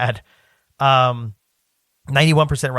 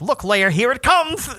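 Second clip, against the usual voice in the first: high, slow toy-villain voice; high-pitched, drawn-out pretend villain; Ben Mendelsohn; 9 semitones higher, slower than usual. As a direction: play tiny villain with an action figure. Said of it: "you spoke like Ben Mendelsohn".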